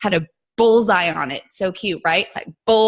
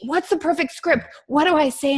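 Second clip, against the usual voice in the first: higher-pitched mock pleading; high, worried questioning; fretting new coach; 8 semitones higher than usual. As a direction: imitate an anxious beginner asking for help. Voices anxious new coaches' questions.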